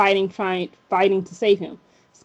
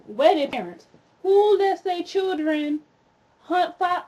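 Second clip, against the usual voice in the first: higher, drawn-out incredulous questioning; indignant; 8 semitones higher, slower than usual. Real, not acted. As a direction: call out those parents in disbelief. Indignant rant at the parents.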